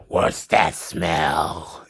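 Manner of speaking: very low, very slow mock sniffing growl; sniffing hero. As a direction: drag it out low like a dog on a scent. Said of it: "starts sniffing like a Doberman".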